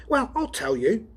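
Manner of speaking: worked-up partner answering back; Donovan's voice. Acted.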